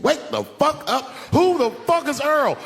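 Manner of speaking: high, angry shouting at his wife; yelling her awake, demanding answers; high furious demand. Retold or imitated. Angry wake-up confrontation.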